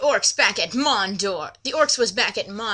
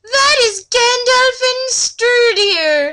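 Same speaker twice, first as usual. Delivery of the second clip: high, slow, loud elf voice; Legolas voice; 12 semitones higher, 6 dB louder, slower than usual. Acted. Legolas naming the riders to Laura, high and slow. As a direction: announce it slowly, way up high. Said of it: "I'm going ever with the voices here".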